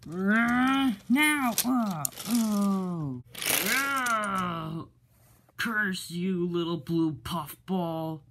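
high, loud crying yelp of a beaten villain; high hammed-up shriek of defeat; villain's defeat cry. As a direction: wail as the villain gets taken down.

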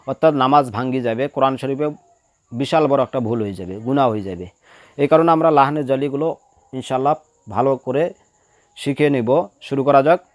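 usual speaking voice

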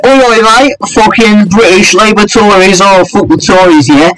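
high, hammed-up British lad accent; mock British accent. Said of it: "they go like".

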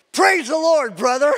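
high, loud joyful praise; excited greeting. Retold or imitated. Excited Christians greeting the bathroom writer, joyful loud praise. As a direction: greet him with big happy energy.

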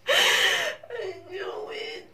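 high, slow sobbing; real tears of joy; crying. Real, not acted. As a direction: sob the words through tears. Crying at the news.

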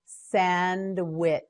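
"Sandwich" stretched, final ch set apart; slow modelling. Shown as a demonstration. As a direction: draw out "Sandwich" part by part. Teacher models word slowly.

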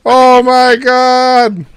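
slow loud oh my god; drawn-out shock. Real, not acted. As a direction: stretch out the shock.

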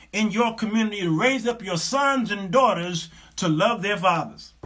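loud, high preacher's exhortation; rally the women from the pulpit; preaching at full force. Acted. Preacher urging women in the audience, full-force preaching.

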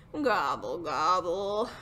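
deep turkey grumble; grumbled gobble. Acted. Turkey sulking after Sheep saw through it, low grumble. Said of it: "grumbled Turkey".

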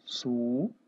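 "Estudar" split as es, su, dar with long pauses; "Estudar" dictated piece by piece as es-su-dar; syllable by syllable. Shown as a demonstration. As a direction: say "Estudar" in isolated syllables, low. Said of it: "Como que se faz a separação?".

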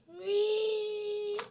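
high, loud squeal of delight; loud gleeful whee. Real, not acted. Gamer launching off the ramp, squealing loudly. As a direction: shriek it like a rollercoaster drop.

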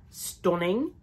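put-on a feeble try at a British accent on stunning; attempted British accent. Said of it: "I can't do a British accent".

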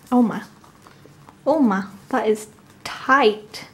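ordinary talk, nothing marked

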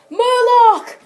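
high-pitched pretend shout; dramatic battle cry. Play-acting toy battle cry.

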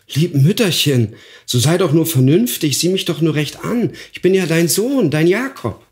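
high, imploring voice, climbing as it goes; desperate pleading. A narrator's reading. Son begging mother to recognise him, high pleading.

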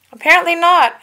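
high, loud dry retort; Anakin wry. A narrator's reading. Anakin answers Obi-Wan, high and loud retort. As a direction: toss off the comeback. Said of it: "Anakin said".

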